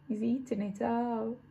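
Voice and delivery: usual speaking voice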